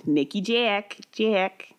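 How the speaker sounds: "Jack" as "Jyeack", Minnesota vowel; Minnesota accent. Shown as a demonstration. Co-host showing how she hated saying her name, Minnesota accent. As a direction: stretch the "a" of "Jack" Minnesota style. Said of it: "my Minnesota accent".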